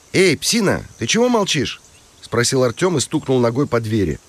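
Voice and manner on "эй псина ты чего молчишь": high-pitched call to a dog. Acted. Boy hails the dog behind the shed door, calling out.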